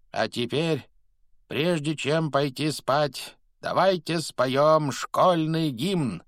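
high, drawn-out proclamation; crying out. A narrator's reading. Headmaster calling the school to sing, cried out. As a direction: call it slowly across a great hall. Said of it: "прокричал Дамблдор".

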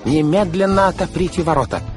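high, loud frantic order; outraged shouted command; shouting. A narrator's reading. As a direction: demand entry at the top of the voice. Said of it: "закричал мистер По".

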